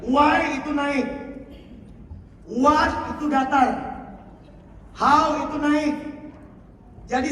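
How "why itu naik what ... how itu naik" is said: "Why" and "How" rise, "What" stays level; rising and level tune. Shown as a demonstration. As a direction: show the tune on each word slowly. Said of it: "Energinya kayak gini". (intonasi naik, datar, naik)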